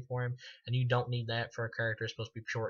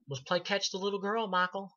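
higher, lightly voiced taunting doctor; a hint of a crazed doctor teasing the killer; mocking Loomis; 8 semitones higher than usual. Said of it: "he's like".

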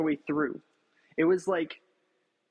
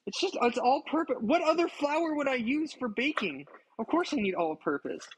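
exasperated; 7 semitones higher than usual. Real, not acted. Exasperated about flour question.

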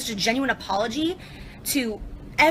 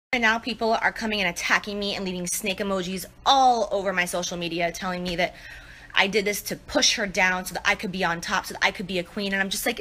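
usual speaking voice

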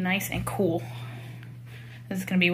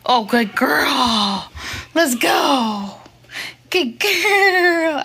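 loud, drawn-out cheering praise; gushing dog praise; 2 semitones higher, 5 dB louder, much slower than usual. Real, not acted. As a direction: cheer the dog on, stretching the words.

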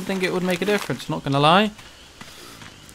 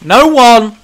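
exasperated shout; 8 semitones higher, 13 dB louder than usual. Real, not acted. Ranting at game logic.

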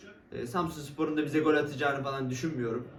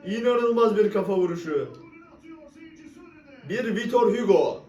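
football commentator; 6 semitones higher, 5 dB louder than usual. Real, not acted. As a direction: call it like live commentary. Commentator-style praise after goal.

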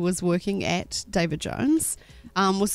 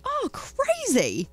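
high, slowly drawn-out reaction; imitated amazement; 13 semitones higher, slower than usual. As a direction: stretch it out in gushy, high-pitched wonder. Retold woman reacting with amazement.

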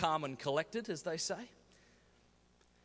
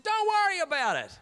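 very high, loud mock brush-off; overdone bravado reassurance; 14 semitones higher, 6 dB louder than usual. Comedian retelling his reply to worried Todd, high shouted bravado. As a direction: squeal it out in hammed-up confidence. Acted yelled reassurance to friend.

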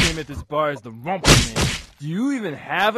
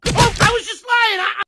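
high, louder panicked squeal; 4 dB louder than usual. Acted.

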